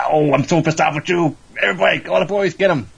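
high-pitched mock outrage; angry order. Acted angry order to attack.

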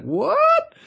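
squeaky, very high shocked yelp; shocked what. Retold or imitated. Host reacting to the episode title with a high shocked squeak.

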